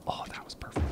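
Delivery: hushed whisper of delight. Real, not acted. Gamer admiring his road layout, whispering. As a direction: breathe it out in a whisper.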